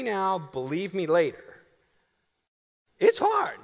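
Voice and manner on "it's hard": sudden loud, high burst; preacher driving home a point, shouting; emphatic shout. Real, not acted. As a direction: shout it so the back row hears. Preacher insisting parenting hard.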